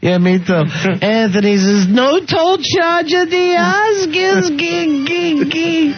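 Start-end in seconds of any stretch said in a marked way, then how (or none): 1.9-4.6 much higher and slow, like a goofy announcer; high slow joke-reading voice. Acted. Host reads a listener's Oscar joke on air in a high, slow goofy voice. 4.6-5.9 much higher, clipped gig gig cackle; high cackling gig. Acted. Host punctuates the joke with a high gig gig cackle. Host's signature gag sound.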